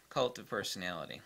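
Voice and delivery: normal talking voice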